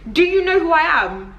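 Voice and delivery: high-pitched mocking imitation; mock self-important question. Quoting the question not understood, mock self-important. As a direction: parody someone pulling rank, voice up high. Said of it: "I don't understand that question".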